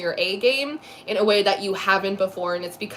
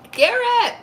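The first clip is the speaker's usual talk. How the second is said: excited call; 14 semitones higher, 4 dB louder than usual. Real, not acted. Calling out a chat name.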